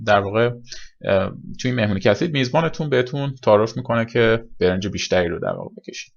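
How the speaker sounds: ordinary talk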